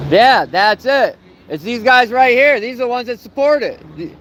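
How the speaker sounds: heckling the people inside, loud and high; calling out. Real, not acted. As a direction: call it out across the lot. Shouting accusations outside parole office.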